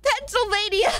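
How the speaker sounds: Pennsylvania! blurted high and relieved; triumphant outburst. Real, not acted. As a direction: nail the word at last, exasperated. Finally gets the word after swearing.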